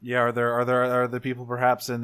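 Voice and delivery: usual speaking voice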